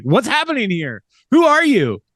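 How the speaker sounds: very high, rising alarmed squeal; hammed-up high alarm; mock bewildered. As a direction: play it like a startled shriek. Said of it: "It's like".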